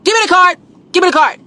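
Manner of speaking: greedy excited grab; excited demand. Pretend.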